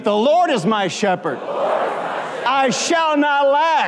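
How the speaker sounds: rising to a high, slow shout; declared with belief. Shown as a demonstration. Preacher urging congregation to believe the psalm, shouted with conviction. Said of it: "Say it like you believe it".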